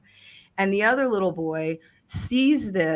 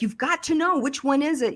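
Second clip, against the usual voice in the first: retold frantic pleading; 8 semitones higher than usual. Desperately pressing the boy.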